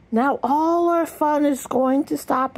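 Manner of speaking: plain reading voice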